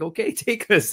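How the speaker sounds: high, laughing mock eager yes; a light laughing imitation of the eager visitors; laughing agreement. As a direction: giggle while saying take us. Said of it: "They're like".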